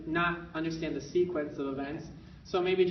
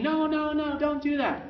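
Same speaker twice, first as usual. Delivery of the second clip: high, louder sharp no-no; scolding parent no; 10 semitones higher, 4 dB louder than usual. Retold or imitated. Parent stopping toddler dripping water, sharp scolding. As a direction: snap a quick no like a frazzled parent. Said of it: "instead of saying".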